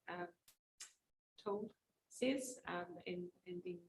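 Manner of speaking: soft, under-projected voice, hesitant with ums; speaking too quietly. Real, not acted. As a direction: say it quietly, barely projecting to the room. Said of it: "Can you speak up a bit".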